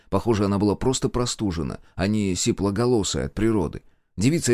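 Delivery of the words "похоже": plain reading voice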